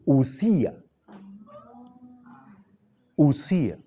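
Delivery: Usía as oo-SÍ-a, stress on the í; corrected pronunciation. Shown as a demonstration. Teacher corrects a mispronunciation, careful repeated model. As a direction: say Usía in clear syllables, starting on pure U. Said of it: "Está mal pronunciado".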